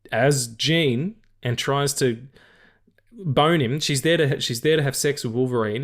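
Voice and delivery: ordinary talk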